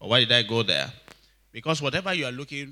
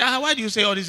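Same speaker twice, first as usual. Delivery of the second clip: high, louder mock protest; retold protest; 9 semitones higher, 5 dB louder, much faster than usual. Preacher acting out his challenge to a joker, mock protest. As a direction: challenge the joker, pitched well up.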